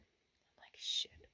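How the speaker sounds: unvoiced-soft whispered curse; whispered reaction. Camper alone as the truck stops, whispered reaction. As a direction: whisper it to yourself in alarm. Retold scared thought while truck waits.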